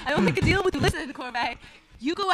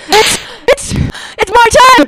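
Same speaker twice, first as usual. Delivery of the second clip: stammering then a loud slow cry of the name; shocked realisation; stunned shout; 7 semitones higher, 10 dB louder, much slower than usual. Acted. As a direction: reel at the unmasking. Unmasks the disguise.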